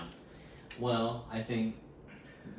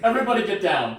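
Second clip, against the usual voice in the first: loud, raised-pitch order to the room; mock shouted command; 7 semitones higher, 12 dB louder, faster than usual. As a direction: shout it like a joking emergency command. Joking hold-up style command, acted.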